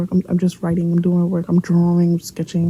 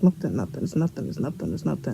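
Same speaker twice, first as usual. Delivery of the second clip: low, very soft repeated denial; dismissive mumble; 3 semitones lower, 8 dB quieter than usual. Retold or imitated. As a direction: re-enact brushing off a teacher under your breath. Brushing off teacher while crying.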